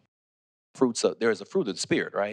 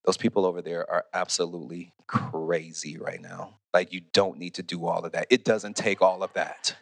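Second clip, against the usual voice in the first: disapproving; 6 semitones lower than usual. Retold or imitated. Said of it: "anyone saying".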